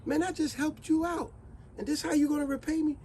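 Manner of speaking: high, indignant disbelief; outraged. A narrator's reading. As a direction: farmer can't believe the ingratitude. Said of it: "And the farmer said".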